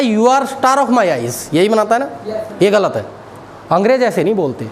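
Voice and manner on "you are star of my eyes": very slow, mocking the wrong English; slow wrong version. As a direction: drag out the learner's mistake. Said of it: "तो बोलता है". (गलत अंग्रेज़ी को धीरे-धीरे चिढ़ाते हुए)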